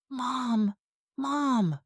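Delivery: high, frightened calling out; scared cry. Acted. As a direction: panic as her mother collapses. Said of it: "I cried, scared".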